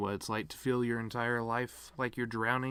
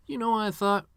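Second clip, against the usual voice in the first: excited lead-in; 11 semitones higher, 6 dB louder than usual. Real, not acted. Building to a panic story.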